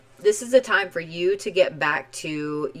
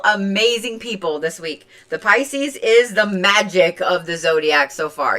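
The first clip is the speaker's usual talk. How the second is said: ordinary talk, nothing marked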